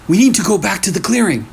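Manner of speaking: urgent high shout, fast. A narrator's reading. Eli shouts to the lost group in panic. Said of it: "Eli shouted".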